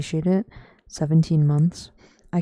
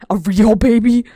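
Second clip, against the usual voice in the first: much louder, delighted; excited exclaim; 4 semitones higher, 13 dB louder than usual. Real, not acted. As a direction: burst out with glee.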